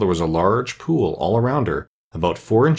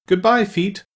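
amazed farewell; 10 semitones higher, slower than usual. A narrator's reading. Alice bidding her feet farewell.

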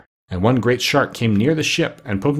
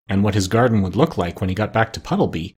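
ordinary delivery

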